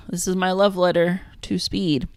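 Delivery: ordinary talk, nothing marked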